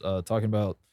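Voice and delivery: burp slipping into the words. Real, not acted. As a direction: say it through a burp. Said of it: "excuse me, had to burp".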